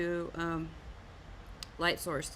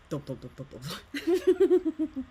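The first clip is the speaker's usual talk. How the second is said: footsteps dissolving into laughter; 6 semitones higher, 6 dB louder, slower than usual. Retold or imitated. Husband walking away after joke.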